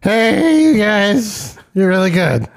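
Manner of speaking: put-on slurred, sloppy drunk caller; drunk dialing. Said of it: "getting drunk and leaving us drunk voicemails".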